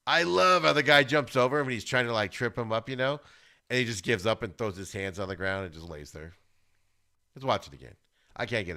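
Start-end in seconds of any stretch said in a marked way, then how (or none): none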